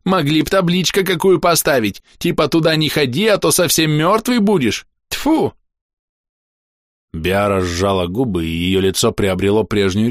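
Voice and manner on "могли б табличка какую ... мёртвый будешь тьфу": high, heated grumbling voice; high-pitched goblin outrage; exasperated goblin. A narrator's reading. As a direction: complain in a temper, ending with a spit. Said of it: "в сердцах воскликнул Тыждак".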